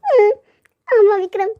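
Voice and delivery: small, high-pitched tearful admission; a little weepy, pitch lifted. Acted. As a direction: admit it while close to crying. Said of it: "அழுகுறியாடி".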